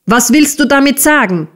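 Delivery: high, fast, scolding question; angry demand. A narrator's reading. Misses Squeers beats and questions Smike, angry scolding. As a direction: demand an answer sharply.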